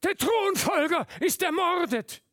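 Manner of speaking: very high, very loud alarm cry; shouted news. A narrator's reading. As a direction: call the news out across the hall. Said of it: "Jemand rief".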